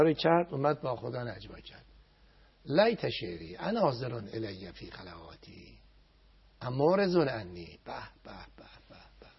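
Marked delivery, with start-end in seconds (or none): 2.7-7.8 slow prayer recitation. Acted. Cleric to viewers, reciting the prayer slowly. 7.8-9.3 hushed admiring bah bah. Real, not acted. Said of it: "حیف است با این ادبیات".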